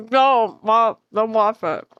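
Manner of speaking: muffled words round a bite; mouth full. Real, not acted. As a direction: plead through a mouthful of food. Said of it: "My mouth is full".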